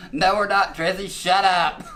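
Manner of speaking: loud, high exasperated yell; sudden holler. Retold or imitated. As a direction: cut off the singing with a big shout. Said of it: "they just hollered".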